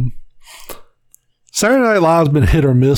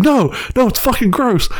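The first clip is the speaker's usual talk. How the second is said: put-on whiny kid; 8 semitones higher than usual. Kyle refusing, voiced higher.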